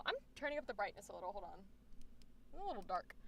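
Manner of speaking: low, very soft talk to self; muttered aside. Real, not acted. Streamer fixing screen brightness, muttering low to self. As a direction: drop to a quick, quiet mutter.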